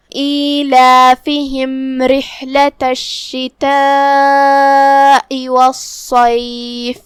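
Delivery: slow, drawn-out melodic tilawah; Arabic tajweed recitation; Quran recitation. Acted. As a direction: recite with long tajweed vowels. Recites the verses slowly.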